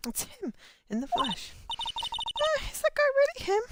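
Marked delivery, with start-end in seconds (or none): 1.7-3.6 startled disbelief. Acted. Shocked at seeing the client.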